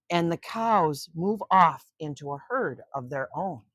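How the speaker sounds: put-on expressive read-aloud narration for kids; story reading. A narrator's reading. Grandma reading a nature book to children, storytelling voice. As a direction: read it like grandma at bedtime, with suspense.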